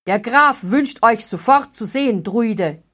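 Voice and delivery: loud, curt command; called out. A narrator's reading. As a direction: call it across the clearing. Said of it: "rief der Hauptmann der Wachen". (rief der Hauptmann laut)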